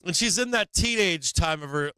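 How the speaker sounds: normal talking voice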